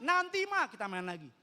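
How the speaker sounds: put-on child calling back. Children yell back to mother.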